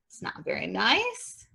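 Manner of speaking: usual speaking voice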